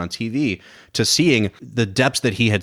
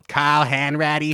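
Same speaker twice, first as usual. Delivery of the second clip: slow, higher mock Boston accent; Hanks Boston accent; 5 semitones higher, slower than usual. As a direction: drawl the name out Boston-style, slowly. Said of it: "Tom Hanks doing a Boston accent".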